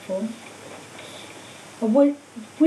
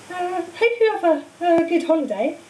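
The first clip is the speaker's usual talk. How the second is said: uncertain Hermione; 7 semitones higher than usual. A narrator's reading. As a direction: trail off unsure, glancing after the uncle. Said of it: "looking uncertainly after".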